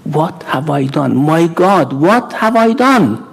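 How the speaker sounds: anguished remorse. Acted. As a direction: cry out in remorse, pitch climbing to a peak. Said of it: "Then he couldn't bear it".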